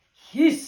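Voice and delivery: loud, sibilant goose hiss; goose's hiss. A narrator's reading. Goose answering the ladybird, loud hiss. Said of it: "said the goose".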